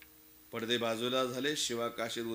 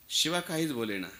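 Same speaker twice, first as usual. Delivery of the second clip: usual speaking voice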